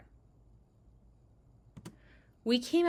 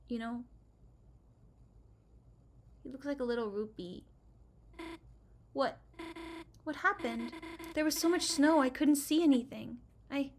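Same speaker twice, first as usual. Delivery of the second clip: usual speaking voice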